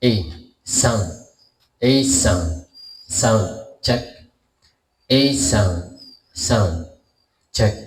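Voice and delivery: loud mic check through a voice changer; deep pitch shifted. Shown as a demonstration. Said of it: "Lalagyan natin ng effects naman".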